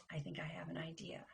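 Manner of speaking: ordinary delivery, nothing marked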